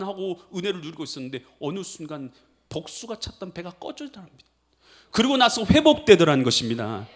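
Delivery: ordinary talk, nothing marked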